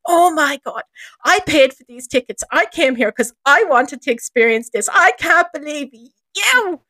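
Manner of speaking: high, indignant tirade; hammed-up affronted rant, slowing at the end; mock outrage. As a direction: imitate a wife blowing up at her husband. Mimics angry wife blowing up.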